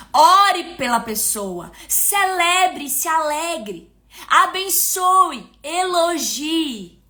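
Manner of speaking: slow, stretched-out commands; deliberate preacher's list, word by word; emphatic listing. Real, not acted. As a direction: draw out each step for the congregation. Preacher spelling out the key's steps.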